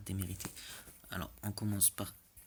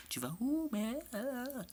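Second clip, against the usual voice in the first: panicked high; slower than usual. Real, not acted. Panicked shouting at teammates.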